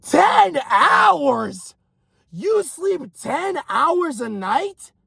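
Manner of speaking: high, drawn-out disbelief; incredulous. Acted. Coach hearing someone sleeps ten hours, incredulous. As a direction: repeat it back high-pitched, appalled.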